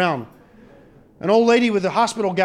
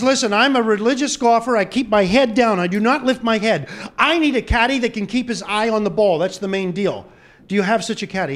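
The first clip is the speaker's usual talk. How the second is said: loud insistent; 6 semitones higher, 6 dB louder than usual. Acted. Golfer demanding a caddy at clubhouse.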